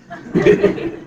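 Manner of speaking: a short, breathy burst of laughter; real laugh. Real, not acted. Dad cracking up at his son's spelling joke, a short real laugh. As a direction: let the laugh slip out.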